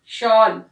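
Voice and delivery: "शॉल" said loud with a clear sh; sh word demo. Shown as a demonstration. Teacher calling out a sh word for the class. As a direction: call out "शॉल" as an example. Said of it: "शलगम के अलावा".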